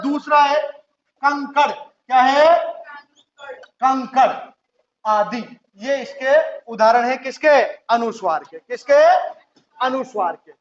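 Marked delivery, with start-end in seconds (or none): none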